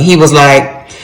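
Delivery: normal talking voice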